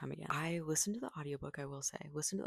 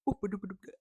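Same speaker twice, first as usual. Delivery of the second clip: mock British babble, a bit higher and louder; fake British accent; 5 semitones higher, 4 dB louder, much faster than usual. Mocking an American narrator faking British, fake British accent. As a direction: ham up a phony British accent in nonsense syllables. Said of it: "have a fake British accent".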